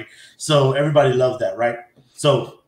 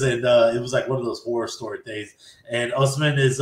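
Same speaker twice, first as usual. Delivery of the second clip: ordinary talk, nothing marked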